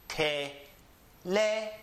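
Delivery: Teléfono broken slowly into te, LÉ, fo-no, with long pauses; syllable by syllable. Shown as a demonstration. Teacher breaking the word down for beginners, slow separated syllables. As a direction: separate the parts of Teléfono, raising LÉ. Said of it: "so we must raise our voice here".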